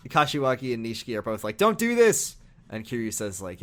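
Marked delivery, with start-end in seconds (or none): 1.6-2.4 pleading shout. Retold or imitated. Both pleading with Kiryu.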